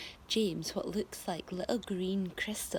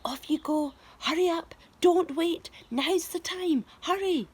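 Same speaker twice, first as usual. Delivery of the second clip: higher, louder, pushing him to go; urgent hurry; 7 semitones higher, 5 dB louder than usual. Acted. Old man hurrying James off, urgent and higher. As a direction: rush him out the door.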